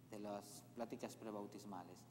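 ordinary talk, nothing marked

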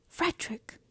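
high, hushed whispered name; yearning whisper. A narrator's reading. Mother naming her absent son, whispered.

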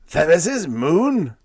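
slow, high, loud croak; captain croaking in shock; croaked disbelief. A narrator's reading. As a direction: croak it out stunned. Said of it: "croaked the old man".

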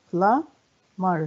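"Plumber" split as plum, pause, ber; syllable by syllable. Shown as a demonstration. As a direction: count out both parts of "Plumber". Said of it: "كم عدد المقاطع".

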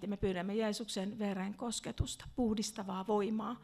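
ordinary talk, nothing marked

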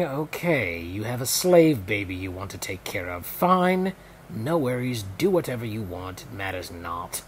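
put-on a lofty Pharaoh in a British accent, shrugging it off. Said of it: "I used the British accent".

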